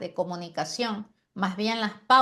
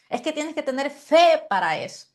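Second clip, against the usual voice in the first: higher, louder preacher emphasis; emphatic demo; 6 semitones higher, 4 dB louder than usual. Shown as a demonstration. Teacher demonstrates emphasis to student preachers, higher and louder. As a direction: preach this line with weight. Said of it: "énfasis en ciertos puntos".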